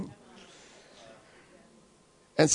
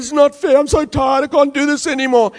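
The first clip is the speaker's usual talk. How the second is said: higher-pitched, put-on whiny victim; whiny complaint; 7 semitones higher, 4 dB louder than usual. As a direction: whine like a worn-out victim. Acting out defeated complaining.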